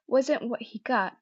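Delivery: usual speaking voice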